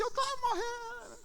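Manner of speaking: high, hammed-up wail; dramatic daughter. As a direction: imitate a little girl melodramatically wailing over a tiny cut. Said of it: "Mulher é muito dramática". (menina dramática, choramingando agudo)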